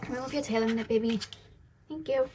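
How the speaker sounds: usual speaking voice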